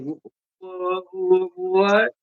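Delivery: high stuttering bewildered wh-; befuddled stammer. Retold or imitated. Mocking a confused friend's high stammer. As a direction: stammer like a lost, confused man.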